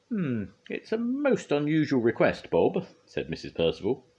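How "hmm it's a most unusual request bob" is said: high-pitched, pondering lady judge; judge's character voice. A narrator's reading. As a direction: weigh an odd request up high. Missus Percival judge voiced.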